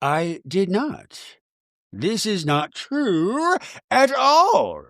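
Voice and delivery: very slow mock-calm denial; Meredith. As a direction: draw each word out with exaggerated composure. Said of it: "Meredith very calmly goes".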